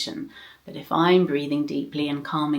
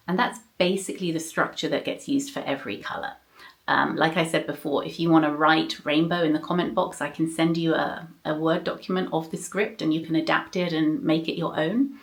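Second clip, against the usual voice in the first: ordinary talk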